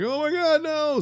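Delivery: high, loud cry of horror; hammed-up panicked shout; horrified cry. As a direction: scream it as she falls. Spider-Man sees Gwen fall.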